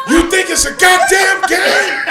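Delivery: loud, high furious shout; angry father yell. Retold or imitated. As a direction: yell it at the kids. Father yelling at the boys.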